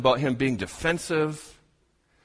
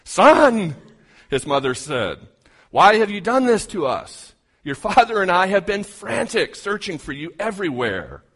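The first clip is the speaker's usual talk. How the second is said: loud, higher, furious parental scolding; a frantic mother scolding her lost son; 5 semitones higher, 6 dB louder than usual. Acted. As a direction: let relief boil over into anger.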